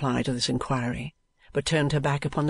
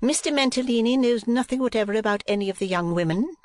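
high, firm wife's voice; jealous madam; 9 semitones higher, 3 dB louder than usual. A narrator's reading. Wife talking at husband through the niece, high jealous voice. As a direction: lay down the law in a high voice.

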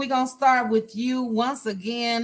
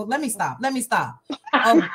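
normal talking voice

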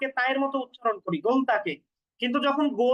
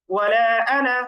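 ওয়ালা আনা recited loudly, আনা short and unstretched; louder chant of ওয়ালা আনা without lengthening; emphatic recitation; 2 semitones lower, 7 dB louder than usual. Shown as a demonstration. Teacher stresses the word for students, loud recited আনা. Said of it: "এখানে কিন্তু আনা".

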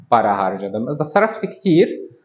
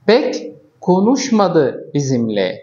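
slow, each word spaced; slow example sentence; 3 semitones higher, much slower than usual. Shown as a demonstration. Teacher modelling a Turkish example for students, slowly. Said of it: "Misal آخر".

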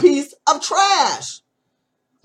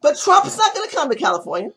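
ordinary talk, nothing marked

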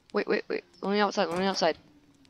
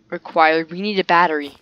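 usual speaking voice

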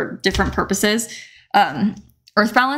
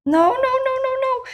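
high panicked shriek; shrieking jackpot winner. Real, not acted. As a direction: squeal it out in panic. Dough rips, panicked.

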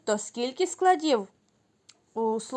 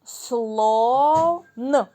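Слон stretched to bring out its sounds, a little louder; sounding out; 4 dB louder than usual. Shown as a demonstration. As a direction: draw out Слон to let the children hear each sound. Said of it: "Наступний звук який". (протяжно, виділяючи звуки)

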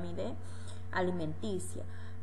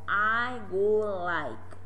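slow model phrase; 5 dB louder, much slower than usual. Shown as a demonstration. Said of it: "teacher models phrase".

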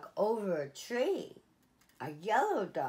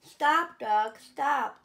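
high, loud call to halt the dogs; calling out stop; 6 semitones higher, 6 dB louder, slower than usual. A narrator's reading. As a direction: shout them to a stop.

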